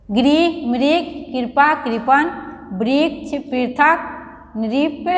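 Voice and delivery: each word spaced out and clearly said, most ending on a rise, slow on नृप, तृण; carefully read word list. Shown as a demonstration. Teacher reading ri-matra words for children to copy, clear and deliberate. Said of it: "तो इसको आप पढ़ेंगे".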